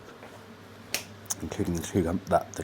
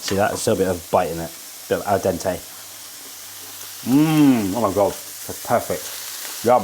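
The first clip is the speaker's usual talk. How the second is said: normal talking voice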